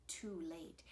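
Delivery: plain reading voice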